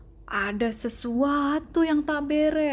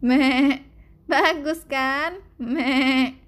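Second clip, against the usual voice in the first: louder sheep voice with bleated meh; bleating sheep; 5 dB louder, much slower than usual. A narrator's reading. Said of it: "tanya mereka".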